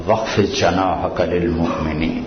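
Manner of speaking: low, soft chanted verse; Quranic recitation. Acted.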